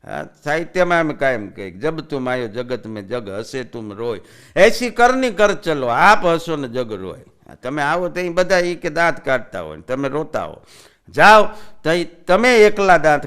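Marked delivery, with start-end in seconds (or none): 1.7-7.2 slow, measured recital; slow poetic recitation; couplet recitation. Acted. 11.1-13.3 sudden loud call; loud burst; loud exclamation. Acted. Comic burst mid-lesson.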